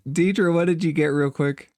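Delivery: normal talking voice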